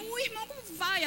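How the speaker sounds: high, bright mock greeting; husband's hearty greeting. Husband greeting church member, high cheerful voice. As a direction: play the outgoing husband greeting a brother. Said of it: "E ele dizia".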